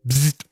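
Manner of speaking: imitated electric buzz; quick buzzing zap noise. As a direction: make a short generator buzz sound.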